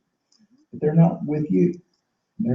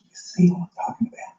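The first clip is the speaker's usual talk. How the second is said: fast, hushed question to the crowd; whispered aside; 5 semitones higher, 6 dB quieter, faster than usual. Real, not acted. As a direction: lean in and whisper it. Sudden whispered aside to audience.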